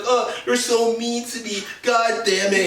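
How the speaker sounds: high, wounded whimper; high self-pitying voice; whiny incel voice. Retold or imitated. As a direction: whine it like a hurt loser. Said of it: "So then he's like".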